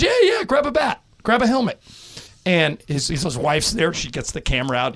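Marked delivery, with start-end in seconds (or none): 0.0-1.8 Lasorda egging on. Retold or imitated. Lasorda encouraging the fan.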